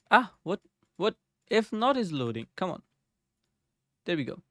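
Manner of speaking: ordinary talk, nothing marked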